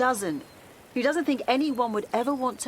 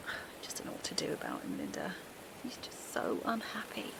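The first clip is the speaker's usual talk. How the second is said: low, soft and broken; hushed helpless despair; despairing whisper; 8 dB quieter than usual. Acted. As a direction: whisper it, out of hope. Mother despairing over her son.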